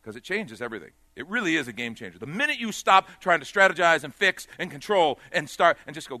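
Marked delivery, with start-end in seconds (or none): 2.2-5.8 loud, rising preacher's call; full-force preaching. Acted. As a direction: drive it home to the congregation, louder.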